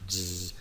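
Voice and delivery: buzzing "zzz" like a wasp or a fly; S sonora exagerada. Shown as a demonstration. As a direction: hum a voiced S, exaggerated. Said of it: "pero vibrando las cuerdas vocales". (zumbido de avispa, ese sonora exagerada)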